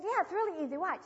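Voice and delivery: put-on a keen boy proud of a trick; proud little boy.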